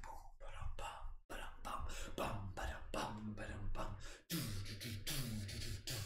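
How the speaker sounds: softly whispered boom ba-dum drum rhythm; whispered drumbeat. Shown as a demonstration. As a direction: breathe out a quiet heartbeat drum. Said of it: "imagine, like, the heartbeat of the drum".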